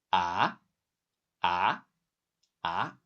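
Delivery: "ah" rising steeply each time; repeated questioning "ah", pitch sweeping upward; second tone. Shown as a demonstration. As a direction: ask "ah" as if saying what?. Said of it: "the pitch is rising, like you're asking what".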